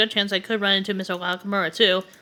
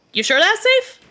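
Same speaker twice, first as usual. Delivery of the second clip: put-on worried girl; 12 semitones higher, 3 dB louder, slower than usual. Girl worries about safety.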